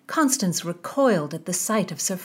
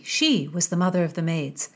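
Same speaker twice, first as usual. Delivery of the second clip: ordinary delivery, nothing marked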